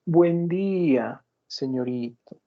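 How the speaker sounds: slow, exaggerated courtly hello; mock the son with overdone politeness; sarcastic good morning to a touchy son; mock formal greeting. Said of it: "te estás haciendo la burla".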